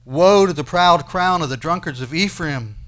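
loud, forceful scripture reading; prophetic declaiming. Acted. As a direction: proclaim the woe at full voice.

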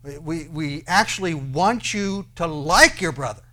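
loud, insistent parental plea; exasperated parent. Acted. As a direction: spell it out to a clueless kid. Acting parent pleading with child.

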